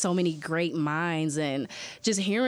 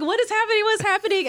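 much higher, louder alarmed cry; retold alarm; 6 dB louder, faster than usual. Hearing a shout from the classroom, alarmed high cry. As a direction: panicky what-is-going-on yelp.

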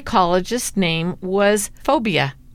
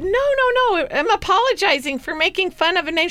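much higher, giggly retold protest; retelling her own laughing apology; 12 semitones higher than usual. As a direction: protest while the laughter bubbles up. Apologizing amid shared laughter.

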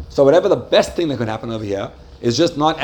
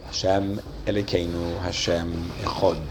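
hushed prayer; 6 semitones lower, 6 dB quieter than usual. Shown as a demonstration. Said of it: "I close my eyes, I say".

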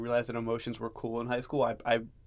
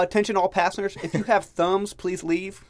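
train announcement; 6 semitones higher than usual. Pretend. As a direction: boom it out over the speakers. Mock train announcement to passengers.

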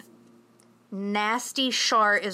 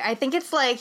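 ordinary talk

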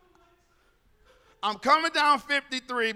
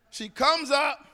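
usual speaking voice

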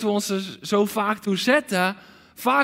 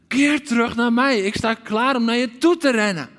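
louder cry from a longing father; waiting father calling; 4 semitones higher, 4 dB louder than usual. Acted. Father waiting daily calling his lost son home. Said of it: "Zoals de vader stond te wachten".

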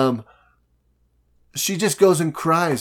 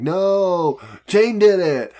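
higher, louder blaming cry; outraged accusing; 6 semitones higher, 5 dB louder, slower than usual. Retold or imitated. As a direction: call out the betrayal indignantly. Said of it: "you're like".

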